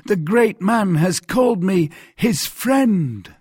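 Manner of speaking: high, slow, ringing exclamation; awestruck cry. A narrator's reading. Mercer called friend by the Cardinal, awestruck cry. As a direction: proclaim it in drawn-out wonder. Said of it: "cried Bonacieux".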